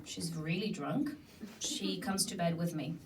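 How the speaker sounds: ordinary delivery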